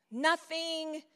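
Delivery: normal talking voice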